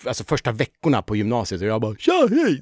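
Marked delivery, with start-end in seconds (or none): 1.9-2.6 put-on squeaky teen hello, much higher and louder; cocky teenager whose voice cracks on the greeting; voice breaking. Teen boy greeting his new mentor, voice cracking. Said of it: "Målbrottet".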